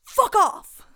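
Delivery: sudden, very high and very loud swearing outburst; mock furious shout. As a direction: blurt it as a yelled punchline. Nun snaps at border guard.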